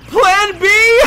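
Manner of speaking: high, very loud shriek of disbelief; full-volume incredulous shout; astonished yell. Real, not acted. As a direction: scream it in amused shock. Astonished yell at on-screen twist.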